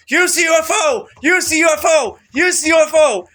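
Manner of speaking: high, loud shout, repeated like a chant; urgent shouting. Retold or imitated. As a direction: shout it as an insistent plea. Retells his pleas to the ministry.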